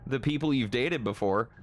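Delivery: normal talking voice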